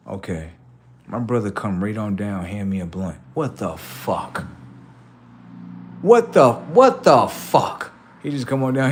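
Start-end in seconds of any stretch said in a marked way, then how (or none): 3.3-4.4 low, slow astonishment; quietly stunned, lowered voice; muted disbelief. Acted. First reaction, then repeated louder. 6.0-7.9 high, loud astonished outburst; incredulous raised cry; loud disbelief. Acted. Stunned reaction, escalating.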